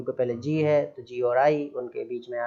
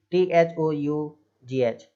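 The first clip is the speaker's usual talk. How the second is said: ordinary talk, nothing marked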